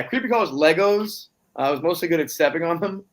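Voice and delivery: ordinary talk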